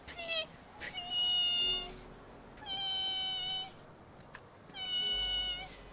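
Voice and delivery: very high squeaky pleading please; squeaky begging. Acted. Pleading with the game to answer, very high squeak. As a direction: beg the game in a tiny high voice. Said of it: "begging the game".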